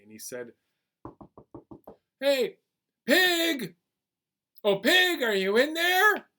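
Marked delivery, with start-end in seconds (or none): 2.2-6.3 loud, high calling out to Pig. Acted. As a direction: shout up to the neighbour's house. Anansi calls Pig from outside.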